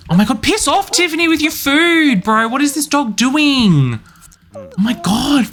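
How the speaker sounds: very high exasperated squeal; real exasperation. Real, not acted. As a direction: scold a pet that interrupts, voice shooting up. Interrupted by pet, scolds it.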